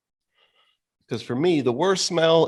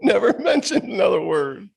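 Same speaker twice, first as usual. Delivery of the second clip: high-pitched, laughing through the words; incredulous laughing talk; 9 semitones higher than usual. Real, not acted. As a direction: crack up while telling it. Laughing while telling it.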